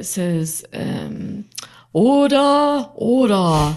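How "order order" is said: drawn-out, higher and louder "Order, order" like the chair of the House; exaggerated deputy speaker. Deputy speaker calling the House to order, hammed-up. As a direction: call the chamber to order with pompous ceremony. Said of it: "they do it in such a kind of exaggerated way".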